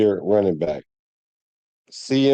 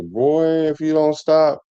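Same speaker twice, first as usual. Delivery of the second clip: higher-pitched rapper impression, drawn-out first word; 5 semitones higher, slower than usual. Vlogger mocking an overheated take, rapper impression. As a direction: imitate the rapper's scolding catchphrase, pitched up. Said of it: "in my [name] voice".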